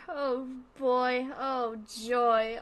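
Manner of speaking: ordinary talk, nothing marked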